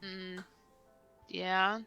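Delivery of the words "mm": normal talking voice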